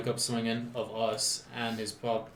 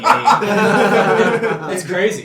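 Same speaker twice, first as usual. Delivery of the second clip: said through a big laugh; cracking up mid-word; 9 semitones higher, 17 dB louder, much slower than usual. Real, not acted. Laughing at old computer.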